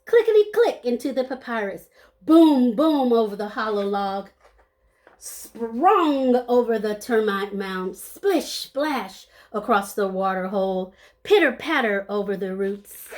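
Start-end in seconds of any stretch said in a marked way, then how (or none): none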